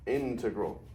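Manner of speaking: INTEGRAL stressed on IN; IN-tegral, emphasis up front; stress on first syllable. Shown as a demonstration. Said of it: "The emphasis is here".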